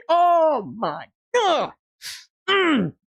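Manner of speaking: high, stretched-out cry of delight; overwhelmed exclamation. Real, not acted. Fan reacting to the cheek kiss, overwhelmed exclamation. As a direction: cry it out slowly, up high, then a squealed hum.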